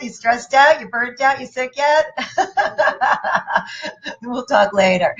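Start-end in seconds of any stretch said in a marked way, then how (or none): 2.1-4.2 a breathy amused laugh at her reply; high airy laugh. Real, not acted. Laughing at Louise's half-hearted yes, light airy laugh.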